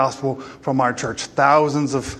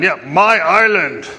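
attempted Irish accent; 2 semitones higher, 3 dB louder, slower than usual. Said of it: "I can't do the accent".

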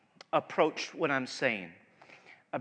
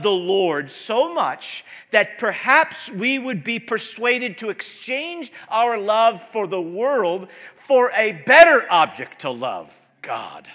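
louder, slow, building preacher's cry; preaching at full force; 5 semitones higher, 11 dB louder than usual. Acted. Pastor urging the church toward God, rising preaching fervor. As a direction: drive it home to the congregation.

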